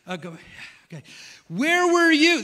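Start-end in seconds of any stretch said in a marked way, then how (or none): 1.5-2.4 high, loud demanding shout; God demanding. Retold or imitated. God confronting Job, loud and high. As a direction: hurl the question at him. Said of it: "This is God".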